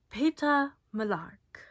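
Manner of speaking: slow, loud, drawn-out announcement; announcing. Acted. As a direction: announce the name to the crowd. Effie announces the boy's name.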